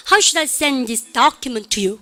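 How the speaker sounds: drawn-out "send", punched "document", tail tossed away; stress on SEND, DOCUMENT. Shown as a demonstration. Teacher leading Daegu students' practice, stress on "send" and "document". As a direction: hit "send" and "document", skim the rest. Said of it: "send document만 여러분들 크게".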